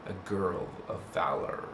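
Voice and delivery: valor dropped low and soft; soft emphasis. Shown as a demonstration. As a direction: lean gently on valor. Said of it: "Notice the word valor".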